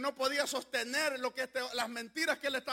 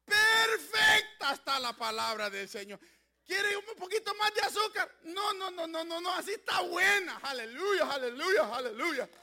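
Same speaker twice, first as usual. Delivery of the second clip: full-force Pentecostal preaching; fiery preaching; 5 semitones higher, 7 dB louder than usual. Acted. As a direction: preach it out to the congregation.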